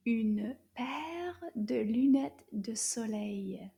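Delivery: slow, careful French phrase; slow French model. Shown as a demonstration. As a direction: model each word slowly for the class.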